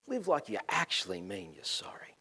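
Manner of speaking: soft, whispered exhortation; hushed urging. Real, not acted. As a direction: drop to a near-whisper to press it home. Preacher drops voice to press point.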